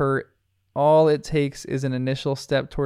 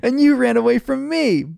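giving her the business; 10 semitones higher, 5 dB louder than usual. Acted. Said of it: "gives her the business".

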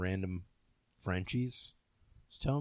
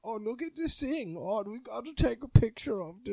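put-on high, excited German tourist accent; 9 semitones higher than usual. Friend mocks a German tourist snapping photos, high German accent. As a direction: gush like a German tourist at a sight. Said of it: "German dude taking a picture".

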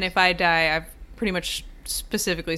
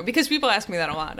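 ordinary delivery

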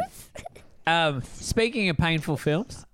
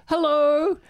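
a much higher, louder cheery hello; Taylor Lautner's hello; 13 semitones higher, 5 dB louder than usual. Retold or imitated. Actor popping into the FaceTime call, loud cheery hello. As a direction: pop in with celebrity charm. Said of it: "popped in and goes".

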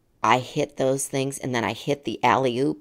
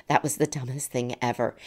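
ordinary talk

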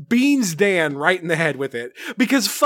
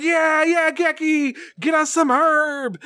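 high, excited mock shout; a hammed-up, high, eager rally cry; hyped cheering; 9 semitones higher, slower than usual. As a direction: cheer like a crowd that wants its prize. Rangers cheer, hyped for the herb.

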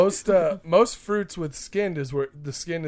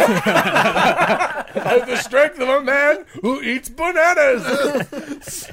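drawn-out boastful brag; slow proud boast; 7 semitones higher, 4 dB louder, much slower than usual. Pretend. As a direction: stretch it out, strutting. Boastful strongman bit.

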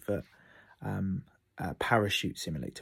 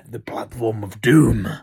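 slow, much louder movie-trailer voice; doom-laden announcer; 12 dB louder, slower than usual. Pretend. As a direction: boom it out like an epic trailer. Dramatic naming of the platform.

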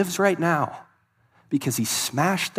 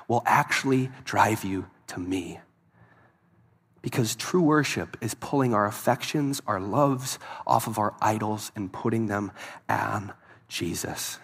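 soft preaching; 7 semitones lower, 5 dB quieter than usual. Real, not acted. Preacher drops to intimate tone.

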